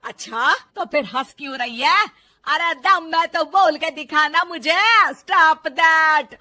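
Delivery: loud, slow, drawn-out dare; insistent angry challenge. Acted. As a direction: goad her at full volume. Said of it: "सास के जोर देने पर".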